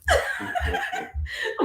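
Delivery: real high burst of laughter. Real, not acted. Host laughing at her own Jamaican accent bit, high laugh.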